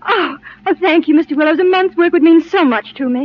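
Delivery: thanks through tears, voice catching; tearful thanks. Acted. Job seeker thanks the boss through tears. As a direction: gush gratitude while still crying. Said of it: "don't cry anymore".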